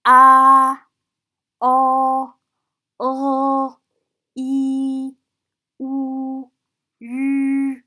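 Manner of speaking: each of "A, o, o, i, u, u" long, loud and level; sustained monotone vowel recitation; vowel drill. Shown as a demonstration. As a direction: hold every vowel steady, pausing between. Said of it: "So let's start from the first one".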